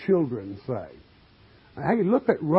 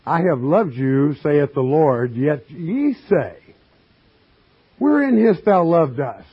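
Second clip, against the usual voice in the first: louder, measured pulpit reading; scripture reading; 5 dB louder than usual. Acted. Preacher reading scripture to his congregation, declaiming. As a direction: read the verse out to the flock.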